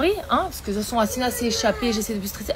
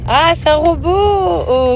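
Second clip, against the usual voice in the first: exaggerated wonder; 6 semitones higher, 5 dB louder, much slower than usual. Real, not acted. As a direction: marvel slowly at the drawing. Praising child's drawing.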